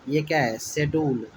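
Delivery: ordinary talk, nothing marked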